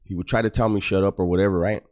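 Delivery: ordinary delivery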